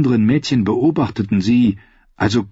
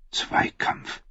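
barely breathed, a voiceless whisper; whispered thought; 9 dB quieter than usual. A narrator's reading. Queen brooding alone at the window, whispered thought. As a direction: hiss the word under the breath.

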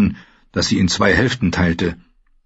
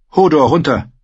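a touch louder, firm; lightly voiced boy's command; 8 semitones higher, 4 dB louder than usual. Bran commands Hodor, firm young voice. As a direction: order the big man down. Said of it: "befahl Bran".

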